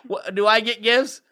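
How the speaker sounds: put-on high, loud child voice, rising question; mock child's question, pitched right up; little kid. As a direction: imitate an insistent kid asking. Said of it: "those little kids go".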